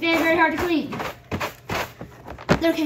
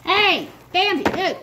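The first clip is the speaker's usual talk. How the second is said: calling out; 6 semitones higher, 6 dB louder, slower than usual. Real, not acted. Calling out to her rabbit.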